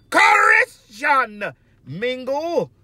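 hollered, stretched shout-out to an app; loud, slow shout of the name; drawn-out shout-out. Real, not acted. Shouted shout-out in vlog greeting.

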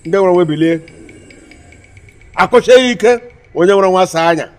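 loud, forceful incantation; commanding summons. Acted. Caller rouses a spirit, commanding. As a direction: order the spirits up loudly.

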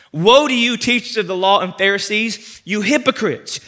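loud, slow preaching rebuke; forceful preaching. Acted. Preacher reading Jesus' rebuke to the congregation, loud and forceful. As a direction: thunder the scripture at the crowd.

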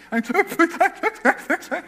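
high, stuttering imitation sobs; mock ugly crying. Kindergartner crying after teacher shouts, mock ugly crying. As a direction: blubber like a little crybaby. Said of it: "I was an ugly crier".

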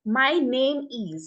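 "my name is" drawn out very slowly; teacher modelling slowly; slow demo. Shown as a demonstration. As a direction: spell it out word by word. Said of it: "একটা জিনিস তোমরা খেয়াল করো".

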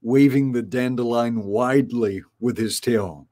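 ordinary delivery

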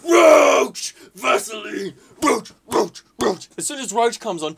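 high, full-voiced shouting; goal celebration. Real, not acted. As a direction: yell the scorers' names in jubilation.

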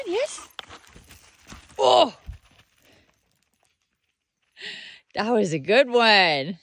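very high, loud cheering; excited dog cheer. Real, not acted. Owner cheering her dog on at fetch, high excited.

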